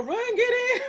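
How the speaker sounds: high, laughing getaway shout; mock urgent call. Driver pulling up to the door for friends, high mock-urgent call.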